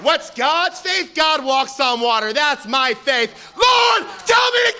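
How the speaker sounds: high slow shouted plea; Peter shouting boldly. Acted. Peter shouts to Jesus, bold and loud. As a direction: cry out like Peter on the boat.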